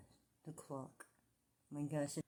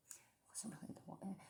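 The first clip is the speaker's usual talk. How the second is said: whispering; 12 dB quieter than usual. Real, not acted. Whispering near the door.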